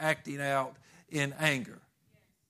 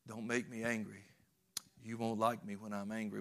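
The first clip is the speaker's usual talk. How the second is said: lower, softer warning, held back; a quiet, brooding quote of the Hulk's famous line; menacing Hulk line; 4 semitones lower, 8 dB quieter than usual. Retold or imitated. Preacher quoting the Hulk's famous warning, low and brooding. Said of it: "there was that one famous line".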